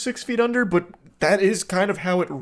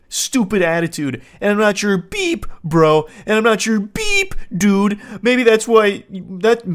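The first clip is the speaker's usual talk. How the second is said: mocking a whiny boyfriend; 4 semitones higher, 4 dB louder than usual. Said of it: "And he's speaking like this".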